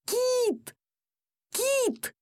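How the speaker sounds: very high, desperate call; yelling. A narrator's reading. Boy calling his pet being taken away, yelling. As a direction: cry the name out high and long. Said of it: "Я орал".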